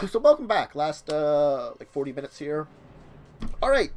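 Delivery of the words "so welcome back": ordinary delivery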